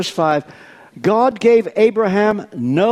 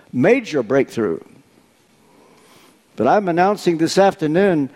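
ordinary talk

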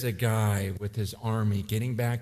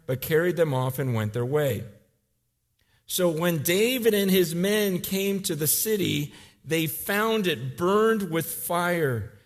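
usual speaking voice

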